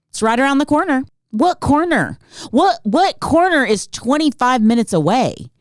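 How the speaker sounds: high, indignant questioning; comic exasperated rant; mock incredulity. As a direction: throw the words back in high-pitched outrage. Incredulous rant at his claim.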